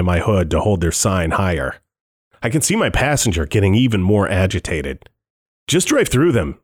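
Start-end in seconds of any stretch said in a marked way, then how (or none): 5.7-6.6 words pushed through clenched teeth; through gritted teeth. Acted. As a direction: speak with your jaw locked tight. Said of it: "he says through gritted teeth".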